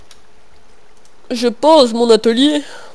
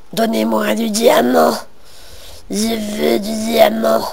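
mock addict; slower than usual. Diamond addict voice.

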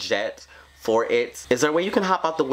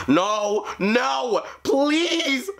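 panicked screaming; much slower than usual. Real, not acted. Caught by Granny.

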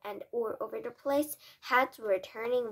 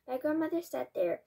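plain reading voice

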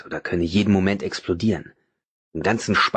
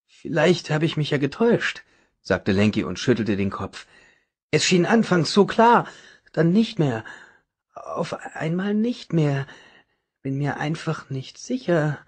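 doubtful and sad; 6 semitones higher than usual. A narrator's reading. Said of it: "Zweifel und Trauer".

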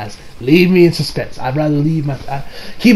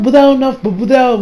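normal talking voice

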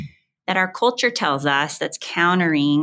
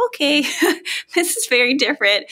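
nervous laugh; 8 semitones higher than usual. Retold or imitated. New mom's overwhelmed thought.

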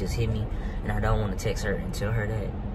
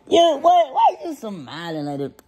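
much higher, louder excited yo; delighted squeal; 13 semitones higher, 6 dB louder, slower than usual. Real, not acted. Baby smiling at him.

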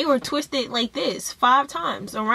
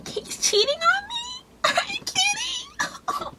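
squeaky, much higher fake sob through laughter; imitating girlfriend's fake teary disbelief, much higher and softer; mock shocked crying; 6 dB quieter, slower than usual. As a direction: play her clueless shock as a whiny, much higher half-laughing sob. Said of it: "she'd be like".

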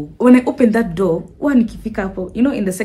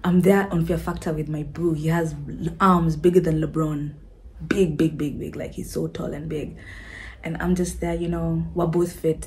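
storytelling voice; 5 semitones lower, 7 dB quieter than usual. Acted. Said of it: "let me give you the picture".

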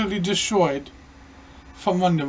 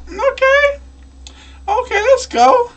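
very high squeaky voice; squeaky high okay; slower than usual. Real, not acted.

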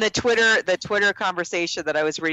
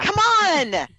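excited; 13 semitones higher, 4 dB louder than usual. Real, not acted. Gushing about loving the movie.

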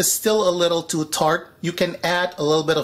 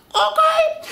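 high emphatic okay. Real, not acted. Emphatic check.

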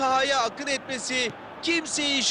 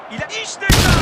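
excited commentator; 6 semitones higher, 9 dB louder than usual. Acted. Commentator sees a chance.

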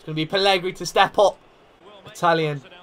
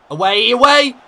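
loud, high call to clear it; urgent shout; 8 semitones higher, 10 dB louder than usual. Real, not acted. Manager urging his defence to clear, shouting. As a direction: yell at them to clear the danger.

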